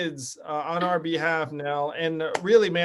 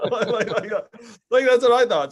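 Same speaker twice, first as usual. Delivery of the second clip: laughing; 4 semitones higher, 4 dB louder than usual. Real, not acted. Laughing while recalling thought.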